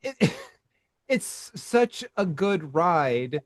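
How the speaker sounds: ordinary talk, nothing marked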